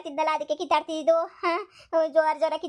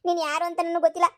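ordinary talk, nothing marked

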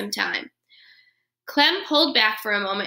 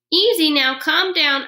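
higher and louder, a squeaky attempt to calm; soothing squeak; 6 semitones higher, 5 dB louder than usual. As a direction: squeak gently to settle a big dog. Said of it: "I squeaked soothingly at the beast".

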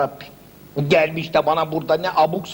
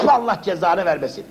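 ordinary delivery

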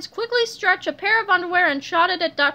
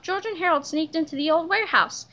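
ordinary delivery, nothing marked

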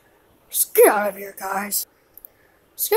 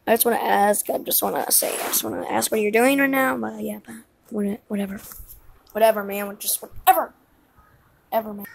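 low, soft mumbling to oneself; hushed, low whatever-man grumble; dismissive muttering; 9 semitones lower, 10 dB quieter, much faster than usual. Acted. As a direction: trail away quietly, giving up on the question. Backs off the question, dismissively.

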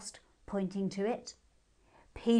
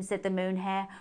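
ordinary delivery

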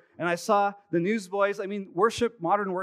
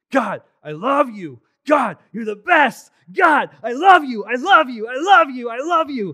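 much higher, louder, slower shouts of worship; shouted praise; 8 semitones higher, 13 dB louder than usual. Retold or imitated. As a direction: cry out to God at full voice. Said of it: "as the worship raised up".

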